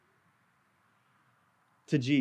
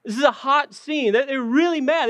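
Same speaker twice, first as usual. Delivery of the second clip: normal talking voice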